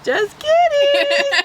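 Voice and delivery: high squeal through laughter. Real, not acted. Squealed joke correction, laughing.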